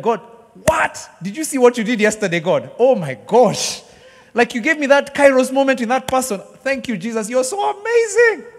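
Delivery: high, loud cheering fan voice; cheering God. Retold or imitated. Worshipper cheering God like a soccer fan, excited. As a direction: cheer God like a goal was scored. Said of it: "cheering God the way I cheer".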